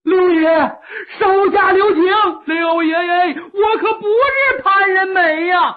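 loud desperate plea for mercy; crying out loud. Acted. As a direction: yell it, begging for your life. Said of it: "犯人扯着嗓子高喊".

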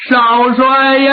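slow wail that breaks and carries; wailing cry. Acted. Mourner kneeling before Zongbao, crying out to him. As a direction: call out to him through tears. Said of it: "哭得特别痛".

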